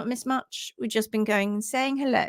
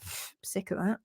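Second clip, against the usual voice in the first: bored horse; 4 semitones lower, 10 dB quieter than usual. Retold or imitated.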